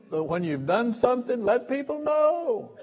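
high, loud laughing brag; laughing boast. Real, not acted. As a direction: crow it out, laughing.

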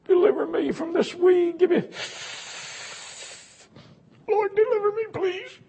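put-on high, loud pleading wail of a drunk begging God; mock drunk's prayer. Preacher mocking a drinker's loud begging prayer. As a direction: ham up a drinker crying out for deliverance.

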